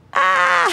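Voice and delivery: high, loud burst of a scream; sudden scream. Acted. Alone in the house, sudden high scream. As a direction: let out one sharp high shriek. Mock scream at her own fear.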